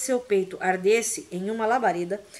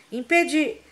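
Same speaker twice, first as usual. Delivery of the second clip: cut-off spell shout; 6 semitones higher, 5 dB louder than usual. Acted.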